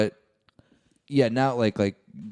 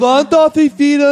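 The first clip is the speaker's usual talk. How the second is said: high, loud whiny German; whiny tourist voice; 14 semitones higher, 10 dB louder than usual. Pretend. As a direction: squeal it like a spoiled tourist. Mocking German holiday-makers.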